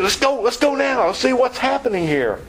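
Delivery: high-pitched, urgent acted excitement; keyed-up urging. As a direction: urge the others on, all worked up. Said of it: "They're all keyed up".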